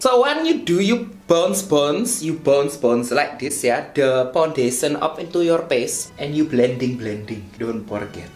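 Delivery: big-city Indonesian street-slang English; Javanese-mixed Indonesian accent. Shown as a demonstration. Vlogger teaching viewers about primer, Javanese-mixed Indonesian accent. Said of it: "I mastered this accent".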